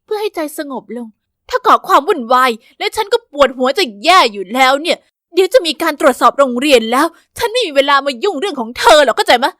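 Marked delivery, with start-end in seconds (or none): 1.3-9.5 loud, fed-up scolding; a headachy principal venting at the student; exasperated principal. Acted.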